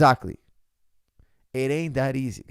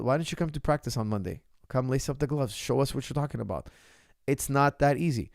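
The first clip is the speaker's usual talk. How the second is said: ordinary talk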